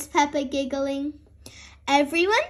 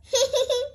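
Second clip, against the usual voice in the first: giggle; 10 semitones higher than usual. Acted. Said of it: "says Peppa".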